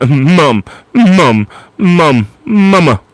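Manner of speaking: high, loud, nagging cartoon toddler; Stewie. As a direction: pester mother with repeated high calls. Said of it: "I can't do, uh, uh, uh, Stewie".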